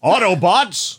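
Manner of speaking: rally the troops in a mock-heroic voice; Optimus Prime. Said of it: "going to use that voice?".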